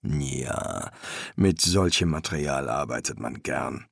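low, soft professional verdict. A narrator's reading. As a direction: pronounce judgement low and quiet. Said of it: "traf ein professionelles Urteil".

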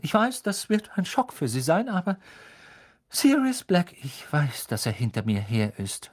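put-on impatient, annoyed boy. A narrator's reading. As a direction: brush off the warning, irritated. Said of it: "sagte Harry genervt".

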